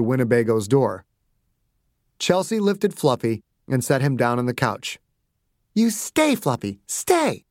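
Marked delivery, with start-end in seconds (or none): 5.7-7.4 put-on high coaxing child voice; special puppy-talk voice. A narrator's reading. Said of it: "in the special voice".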